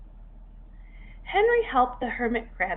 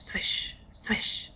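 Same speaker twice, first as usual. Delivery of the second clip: whispered swish; 6 dB quieter than usual. A narrator's reading. Snake slithering.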